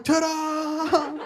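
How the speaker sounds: high, drawn-out TA-DA fanfare; triumphant sound effect. Acted. Man getting naked for the first time, triumphant TA-DA. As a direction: sing out TA-DA high like a big reveal. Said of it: "I made a sound effect".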